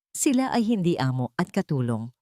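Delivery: usual speaking voice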